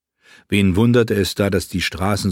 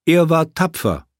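much higher, earnest protest; earnest young prince; 9 semitones higher, 3 dB louder than usual. Acted. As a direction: insist sincerely as the young prince. Quentin defends the dead. (deutlich höher, ernster Einwand)

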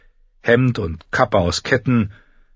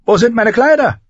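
loud, high, irritated bark; high-pitched impatient shout; barked demand; 6 dB louder than usual. Acted. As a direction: snap the question at someone in a rush. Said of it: "bellte er Shay an".

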